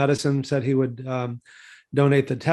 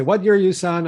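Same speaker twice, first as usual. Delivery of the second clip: higher, louder voice of the imposing chief surgeon; demanding surgeon; 6 semitones higher, 5 dB louder than usual. Retold or imitated. The famous surgeon questioning the medical student, higher and louder. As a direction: put the student on the spot, up high and loud.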